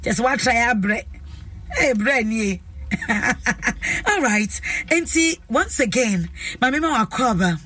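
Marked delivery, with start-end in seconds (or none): 2.6-4.0 breathy run of laughter; hearty chuckle. Real, not acted. Host amused by her own remark to the audience, chuckling.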